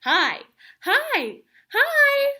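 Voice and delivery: loud, high, cheerful shouts of hi; calling hi out loud. Shown as a demonstration. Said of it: "Can you all say hi?".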